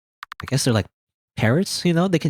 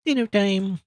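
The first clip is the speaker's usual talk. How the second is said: higher, sing-song dinner call; playful call; 9 semitones higher than usual. Acted. Player sing-songs dinner time to the monsters. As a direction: call it out like a cheery parent.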